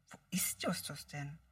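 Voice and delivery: ordinary delivery, nothing marked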